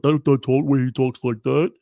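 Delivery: loud mock potato-voice impression; Cardiff Electric. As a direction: imitate someone's fake character voice. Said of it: "this is a voice he put".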